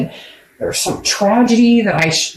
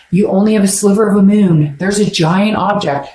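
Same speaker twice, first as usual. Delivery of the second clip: usual speaking voice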